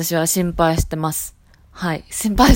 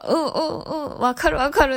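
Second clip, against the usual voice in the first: higher, keen "うん、うん" of a Hiroshima local; eager nodding agreement; 9 semitones higher, faster than usual. Retold or imitated. Hiroshima locals nodding along to the blogger, mock eager agreement. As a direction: chime in eagerly like a local who gets it. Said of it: "広島の人はすごい".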